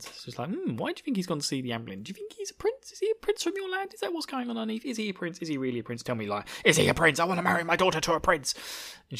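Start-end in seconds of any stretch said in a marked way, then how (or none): 0.5-5.4 very high-pitched pestering women; gossipy falsetto, hammed up; nosy serving ladies. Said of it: "keep stopping her and asking her questions". 5.4-8.5 louder, rising, insistent badgering; mock-desperate, quickening pleading; pestering serving lady. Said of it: "keep stopping her and asking her questions".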